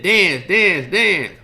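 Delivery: loud, high barked order; mock gangster shout. Tommy yelling at Spider to dance, loud shout. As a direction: yell it like a cocky wiseguy with a gun. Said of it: "And then he said".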